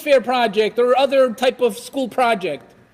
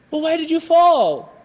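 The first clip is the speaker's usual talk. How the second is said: put-on pitched-up parent prompting a child; questioning parent; 5 semitones higher, 3 dB louder than usual. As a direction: ask as a parent, pointedly.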